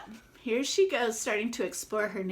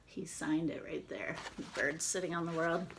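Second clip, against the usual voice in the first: whisper; 5 semitones lower, 7 dB quieter, faster than usual. Real, not acted. Showing the signature to the kids.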